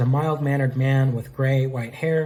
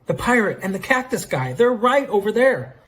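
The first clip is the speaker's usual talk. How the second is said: high, urgent, getting louder; excited pointing out; 8 semitones higher, 3 dB louder than usual. Acted. Jill points out the pirate and cactus guy, excited exclamation. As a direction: exclaim it insistently, voice climbing.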